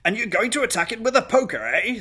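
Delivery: high, loud, incredulous guest; hearty party guest. Acted. Guest quizzes governess about the poker, loud and incredulous. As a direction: boom it out high as a tickled guest.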